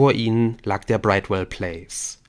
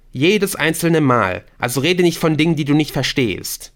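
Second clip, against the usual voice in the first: higher, louder rebuke; defiant, emphatic raised voice; defiant retort; 6 semitones higher, 6 dB louder than usual. Acted. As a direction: put the outsider in their place. Masked captor snaps back.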